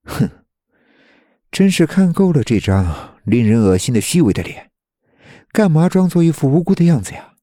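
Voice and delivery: scornful, jeering taunt; mocking. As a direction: sneer down at him. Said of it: "嘲讽道".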